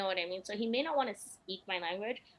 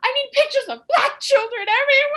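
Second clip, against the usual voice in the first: frantic demand; 15 dB louder than usual. Retold or imitated. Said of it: "I was like".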